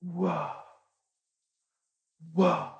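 very soft, hushed reaction of a stunned crowd; awed whoa. Acted. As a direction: let it out softly, overawed. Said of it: "And they're all going".

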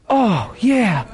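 high, whimpering recollection; tearful realization. Retold or imitated. Crying friend remembers he needed the bathroom, tearful realization. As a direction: let it dawn in a mock-crying voice.

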